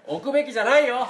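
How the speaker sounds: high, loud emphatic protest; loud declaration. Real, not acted. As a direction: proclaim it at the top of your voice. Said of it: "大にして言いたい。".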